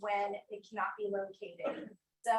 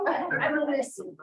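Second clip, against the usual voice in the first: louder and higher, still shaken; "I will miss you" said louder and higher, swelling with emotion; emotional; 6 semitones higher, 8 dB louder than usual. Real, not acted. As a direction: push the goodbye out with feeling. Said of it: "I'm being so emotional".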